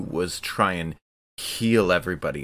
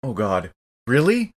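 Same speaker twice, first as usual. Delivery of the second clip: surprised; 5 semitones higher, 4 dB louder than usual. Real, not acted. Player reacts to a surprise request.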